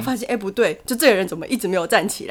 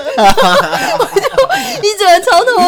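much higher and louder, laughing; laughing hard; 9 dB louder, much slower than usual. Real, not acted. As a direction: laugh through the word pain. Laughing retelling pain. (大笑着说超痛)

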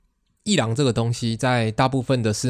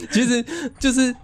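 laughing; 14 semitones higher than usual. Real, not acted. Still laughing, resuming topic.

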